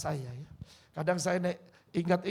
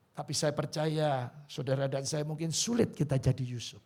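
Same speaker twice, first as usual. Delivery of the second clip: ordinary talk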